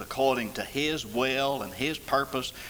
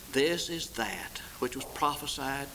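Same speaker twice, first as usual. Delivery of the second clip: hushed whisper; 4 dB quieter than usual. Acted. Peter's answer, hushed.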